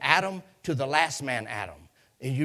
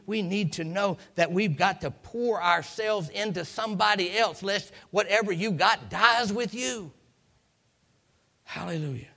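usual speaking voice

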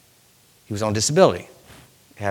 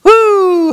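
high shout of praise; joyful whoop; 4 dB louder than usual. Real, not acted. Preacher whooping in praise, high. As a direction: let out a joyful woo. Worship exclamation.

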